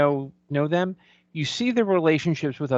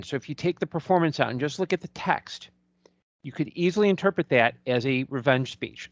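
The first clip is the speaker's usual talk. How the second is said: usual speaking voice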